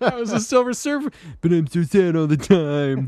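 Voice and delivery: lightly voiced mopey hero; Silver Surfer. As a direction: moan it like a sad cosmic hero. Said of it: "That's my Silver Surfer impression".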